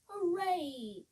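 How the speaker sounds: cheerful cry of hooray; a bright hooray from the scarecrows; happy cry. Acted. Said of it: "cried the scarecrows".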